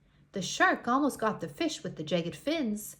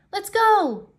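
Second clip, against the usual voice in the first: much higher, loud call; rallying shout; 10 semitones higher, 11 dB louder than usual. Acted. Rainbow Fish shouts to the others to follow him. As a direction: shout to lead the charge.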